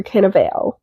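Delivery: Cannavale ending in "vale", rhyming with sail; alternative pronunciation. Shown as a demonstration. Last guess at an actor's surname, Cannavale tried as "can-a-vail". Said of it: "however his name is pronounced".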